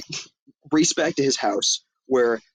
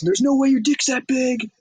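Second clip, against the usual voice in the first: high, loud outraged yell; yelling at the kid in disbelief; 13 semitones higher, 3 dB louder than usual. Retold or imitated. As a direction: shout it across the store, much higher and louder. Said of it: "Vince Vaughn yells".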